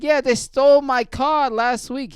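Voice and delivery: high, slow, loud Jamaican mimicry; imitated Jamaican accent. Jamaican dude tells mechanic about his stolen car, high Jamaican accent. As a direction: stretch it out in a hammed-up Jamaican accent. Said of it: "I don't even know what the accent he has".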